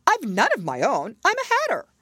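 pleading he is only a hatter; the Hatter explaining. A narrator's reading. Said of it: "the Hatter added as an explanation".

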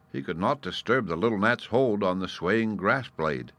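plain reading voice

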